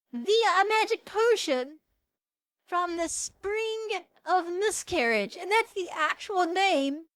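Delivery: high, slow, disbelieving emphasis; incredulous. Real, not acted. Vlogger stunned by the potion's name, high, slow disbelief.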